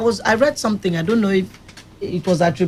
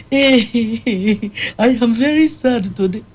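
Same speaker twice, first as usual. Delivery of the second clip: a light imitation of a laughing, plaintive mother; mother's voice; 4 semitones higher, much slower than usual. Narrator imitating the patient's mother on the phone, lightly voiced. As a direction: voice the mother lightly, half-laughing, a bit higher.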